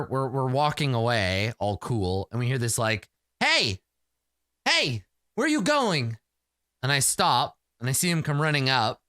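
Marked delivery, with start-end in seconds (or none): 3.4-6.2 high shouted "Hey!" across a distance; calling out. Acted. Warrior shouts after the leaving party, calling high. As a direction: call after someone walking away.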